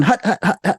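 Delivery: high staccato huts; short, rhythmic high grunts; imitated marching chant. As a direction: mimic little creatures chanting in step. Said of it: "But they're going".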